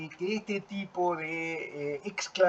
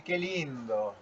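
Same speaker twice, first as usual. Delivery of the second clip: ironic "qué lindo"; 7 dB quieter, slower than usual. Shown as a demonstration. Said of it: "Con una entonación damos vuelta el sentido".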